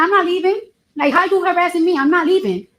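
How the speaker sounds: high, heated insistence; retold heated refusal. Witness retelling refusing the guard's order to leave, heated high voice. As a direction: push back, pitch well up.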